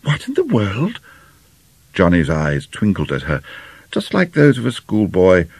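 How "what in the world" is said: high, astonished exclamation; shocked gasp. A narrator's reading. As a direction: break off in indignant astonishment. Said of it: "she gasped".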